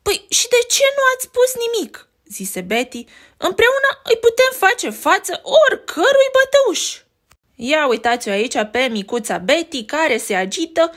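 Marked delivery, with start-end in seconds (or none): 0.0-2.1 high, fast whisper; little sister whispering to her brothers; high whispered little girl. Acted. Betty speaks to brothers. 3.4-7.0 high, loud rallying cry; rally them boldly; brave little sister. Acted. Betty rallies her brothers.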